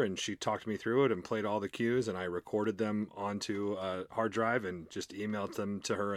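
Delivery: normal talking voice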